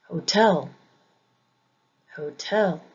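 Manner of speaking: "hotel" with the weight on TEL, ho-TEL; "hotel" pronounced as a demo, light ho, strong TEL; second-syllable stress. Shown as a demonstration. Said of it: "not all English words follow this pattern".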